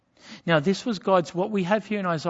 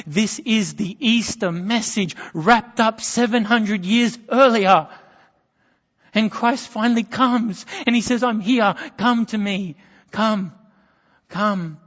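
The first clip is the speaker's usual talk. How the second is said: preaching; 5 semitones higher, 6 dB louder than usual. Acted. Easter climax of sermon.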